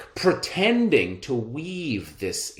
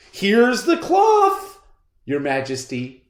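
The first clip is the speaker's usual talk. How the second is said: fawning swindler; 9 semitones higher, 5 dB louder than usual. Acted. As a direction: present it like a flattering con man. Swindlers present cloth.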